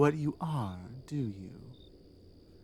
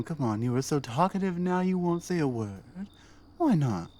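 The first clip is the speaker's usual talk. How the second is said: usual speaking voice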